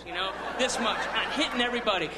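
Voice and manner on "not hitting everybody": ordinary delivery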